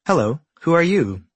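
light high alien voice; a curious alien asking. Acted. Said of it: "the alien asks the new creatures".

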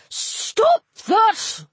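high squeaky scold. A narrator's reading. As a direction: squeak out a sharp stop. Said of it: "said a squeaky voice".

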